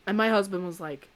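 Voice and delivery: ordinary delivery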